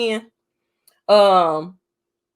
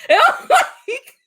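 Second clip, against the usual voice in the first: laughing hard; cracking up; 4 dB louder, much slower than usual. Real, not acted. Laughing while retelling.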